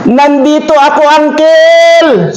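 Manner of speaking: put-on boy answers the uncle; calling back. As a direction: call back from the ditch. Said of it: "Sabi niyan".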